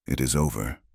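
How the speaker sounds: soft, hushed relief; a lover's quiet reassurance, hushed; whispered. A narrator's reading. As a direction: say it low and soft, barely voiced. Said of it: "Caleb whispered".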